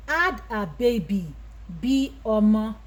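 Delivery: ordinary talk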